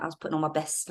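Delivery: ordinary delivery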